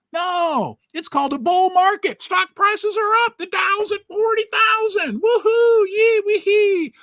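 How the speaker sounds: high, loud hammed-up whooping; mock cheering. The vlogger mimics the one percent celebrating stock gains, with hammed-up loud whoops. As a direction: gloat like a rich investor at a party. Mocking the rich cheering the market.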